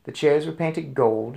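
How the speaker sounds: ordinary delivery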